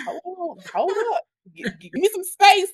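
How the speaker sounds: high, squeaky mock plea for space; high-pitched, stammering "back up" voice; playful fending-off. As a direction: act out being mobbed by eager kids. Acting herself fending off eager kids.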